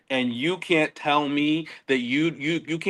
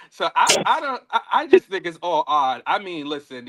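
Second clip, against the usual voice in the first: normal talking voice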